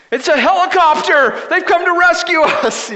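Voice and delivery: high, loud mock shout of a stranded man; retold excited yell. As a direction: imitate a tipsy guy hollering for rescue. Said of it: "the one guy yells".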